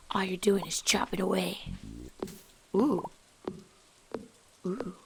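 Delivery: soft unvoiced whisper. Real, not acted. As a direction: murmur it quietly to yourself. Whispers while chopping.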